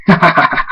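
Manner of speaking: mocking villain's laughter. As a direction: laugh at a helpless foe.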